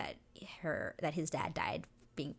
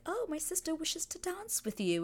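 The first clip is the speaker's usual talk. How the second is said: much higher, put-on courteous voice; mock-polite lady; 11 semitones higher than usual. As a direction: imitate a lady making a formal request. Voicing Kate addressing Anthony.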